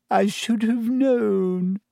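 put-on high, slow, wounded girl's voice; tragic. A narrator's reading. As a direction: drag it out like a tragedy. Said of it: "said Lavender tragically".

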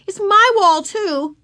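high, loud complaint to mother; tattletale cry. A narrator's reading. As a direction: whine on your sister at full voice. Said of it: "cried Tattletale Beezus".

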